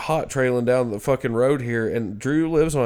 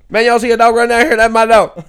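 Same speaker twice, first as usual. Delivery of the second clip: higher, louder and fast, frantic; imitating a frantic woman asking about her dog; high-strung neighbour; 12 semitones higher, 12 dB louder than usual. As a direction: rattle it off like a wired stranger. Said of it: "And she's like".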